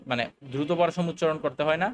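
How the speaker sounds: normal talking voice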